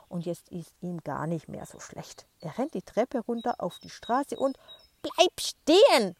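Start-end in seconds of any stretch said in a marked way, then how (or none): 5.0-6.2 slow, very loud and high screech; shrieking command. Acted. Grandma screeches at the boy to stop, slow and loud. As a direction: screech it as a panicked warning. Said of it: "kreischt die Oma".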